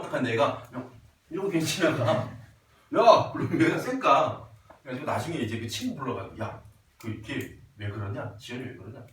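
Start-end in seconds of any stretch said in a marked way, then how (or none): 2.1-3.3 short, high, loud mock call. Calling a classmate who passes by. 6.4-9.0 imitating a quiet, low aside; low-key inquiry. Teacher privately asking a student's friend.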